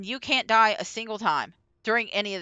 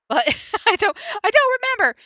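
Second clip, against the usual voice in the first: real amused laughing; 9 semitones higher, faster than usual. Real, not acted. Admitting to forgetting the explanation, amused laughing. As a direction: laugh breaking into high-pitched words.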